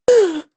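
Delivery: shocked gasp. Retold or imitated. Retold shocked reaction.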